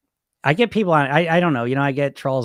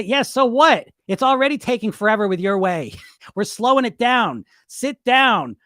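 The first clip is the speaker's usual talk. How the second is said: higher, defiant pushback; firm comeback; 8 semitones higher, 4 dB louder than usual. Acted. Answering his inner bully, defiant and firm. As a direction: put the bully in its place.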